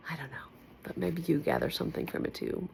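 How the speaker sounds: fast, soft whisper. Real, not acted. She whispers to viewers after sing-songing. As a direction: let it slip out as a whisper.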